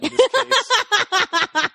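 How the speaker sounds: loud, slow, laughing; laughing through words. Real, not acted. Joking about dying from the drinking game, laughing hard. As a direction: crack up while getting the line out.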